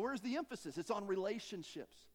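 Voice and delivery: usual speaking voice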